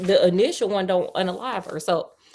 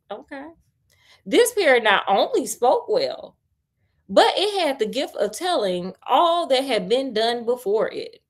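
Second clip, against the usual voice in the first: story narration; 3 semitones higher than usual. A narrator's reading. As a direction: read the tale to a friend, a touch dramatic. Reads the tale aloud.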